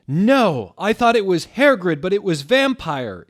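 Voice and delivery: high, loud distressed scream. Acted. Harry screaming about Vampire. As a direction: scream the realization. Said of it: "he started to scream".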